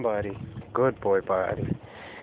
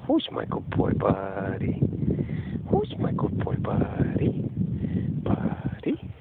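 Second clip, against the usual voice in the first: squeaky praising a dog; 6 semitones lower, slower than usual. Real, not acted.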